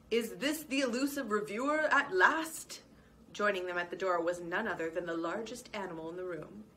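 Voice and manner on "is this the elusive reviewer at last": a louder, big old badger voice; booming badger. Badger hotel owner booming as he joins them at the door. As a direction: boom it out like the biggest animal in the room. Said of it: "said a booming voice".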